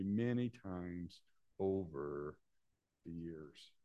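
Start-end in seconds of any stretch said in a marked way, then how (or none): none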